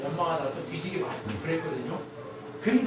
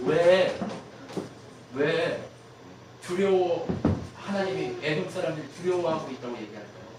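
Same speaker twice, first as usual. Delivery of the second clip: normal talking voice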